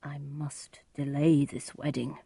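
soft, slow musing; hushed, slow aside to himself; private thought. Acted. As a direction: think aloud under your voice. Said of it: "thought the friar".

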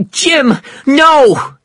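very high, loud cry of alarm; wife's full-voiced horrified scream. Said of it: "screamed Sarah".

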